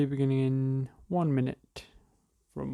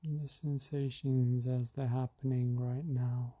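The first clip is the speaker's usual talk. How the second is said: guided meditation; slower than usual. Acted. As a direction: ease listeners into the breath gently.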